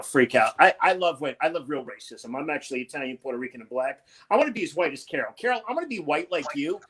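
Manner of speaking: ordinary delivery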